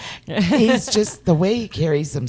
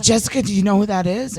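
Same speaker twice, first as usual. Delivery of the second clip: excited; 6 semitones higher, 3 dB louder, faster than usual. Retold or imitated. Excited fan spotting celebrity.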